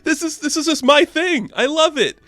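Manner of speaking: high, loud burst of real excitement; gushing excitement. Real, not acted. Gushing about her favourite book.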